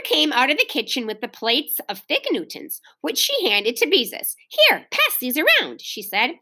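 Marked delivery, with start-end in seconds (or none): 4.5-5.8 brisk instruction. A narrator's reading. Mother handing out plates, busy.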